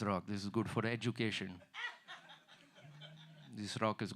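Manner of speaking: mock guru with a heavy Indian accent; Indian-accented mystic healer. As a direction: prescribe cures solemnly in an Indian accent. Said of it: "say basic shit in Indian accent".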